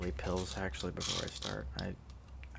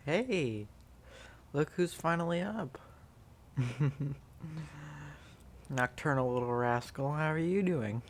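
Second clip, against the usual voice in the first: pet talk; 5 semitones higher than usual. Real, not acted. Owner greeting the sleepy kitten, high cooing pet talk. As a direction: coo at the waking kitten.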